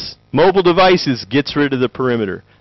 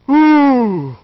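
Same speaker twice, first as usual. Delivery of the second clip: mock awed students; 10 semitones higher than usual. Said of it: "everybody's like".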